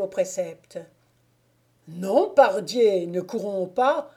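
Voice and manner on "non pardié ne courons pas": loud, drawn-out refusal easing off; a touch of gritted-teeth impatience; exasperated cry. A narrator's reading. Moquet stopping the youngster from running, crying out. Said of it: "cria Moquet en serrant les dents et en frappant du pied".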